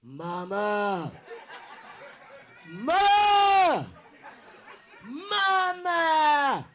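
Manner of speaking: big-voiced baby wailing for mama; newborn calling mama. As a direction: holler for mama like a hungry newborn. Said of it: "My mama had me push me out".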